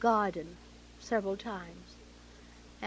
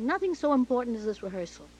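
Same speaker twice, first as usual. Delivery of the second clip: loud, quick, higher dismissal; retold rebuke; 4 semitones higher, 8 dB louder, faster than usual. Director insisting rehearsal matters most, rebuking her. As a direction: cut them off loudly and fast.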